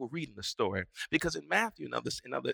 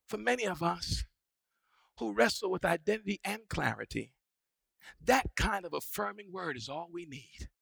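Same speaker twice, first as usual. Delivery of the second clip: usual speaking voice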